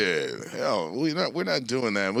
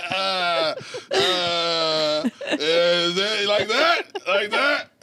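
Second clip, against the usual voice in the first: high, slow, laughing unsure question; nervous laughing; 6 semitones higher, much slower than usual. Retold or imitated. Actor retelling first take with Aniston, laughing and unsure. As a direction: fumble through it laughing at himself.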